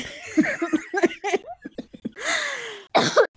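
long high squealing laugh; real giggle fit; helpless giggle fit. Real, not acted. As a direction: crack up uncontrollably. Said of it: "God, I'm just dying".

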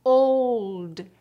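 put-on old with English diphthong. Shown as a demonstration. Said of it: "como a letra O na palavra".

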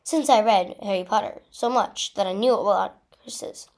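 ordinary delivery, nothing marked

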